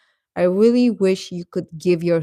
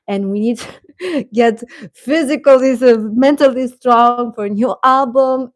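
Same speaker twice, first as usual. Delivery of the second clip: higher and louder, laughing through it; excited fan; 6 semitones higher, 7 dB louder than usual. Real, not acted. Hyping fans for Big Bang's comeback, excited and laughing. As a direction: gush about the comeback with a giggle. Fangirl hype about Big Bang.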